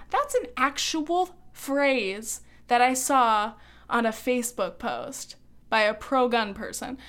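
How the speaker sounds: ordinary delivery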